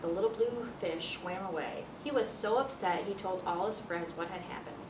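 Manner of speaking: ordinary delivery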